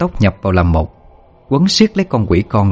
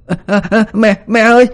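very high, rising panicked wail; a child dissolving, screaming for mom; child crying out; 13 semitones higher than usual. A narrator's reading. Dying ghost child wailing for his mother, very high. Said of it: "Nó kêu lên".

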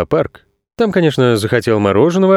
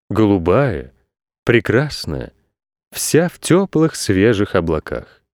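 plain reading voice

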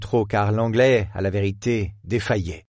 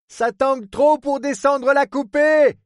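high, slow voiced shout across the water; 4 dB louder, slower than usual. Captain Stout shouting to the superintendent from the deck. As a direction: call out over the swell, drawn out. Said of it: "cria Stout au surintendant".

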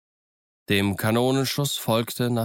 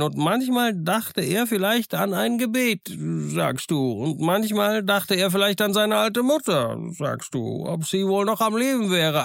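higher, lively voice of a half-wild marooned sailor; higher-pitched, eager old castaway; eager castaway Ben Gunn; 10 semitones higher than usual. Acted. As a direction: play the odd, chattering island hermit, pleased with himself. Ben Gunn coaching Jim.

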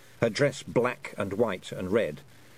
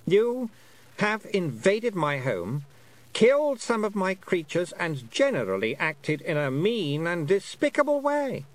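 high, drawn-out regal accusation; offended Queen; 9 semitones higher, 3 dB louder than usual. A narrator's reading. Queen charging the intruder with misdeeds, high offended voice. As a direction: list the crimes as an affronted queen. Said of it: "said the Queen".

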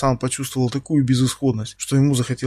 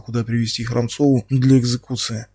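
usual speaking voice

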